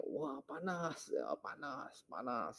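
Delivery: soft, drawn-out mock wail; the pretender groaning that it's hot; retold complaint of heat. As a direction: moan that it burns, hammed up. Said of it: "langsung teriak-teriak dia".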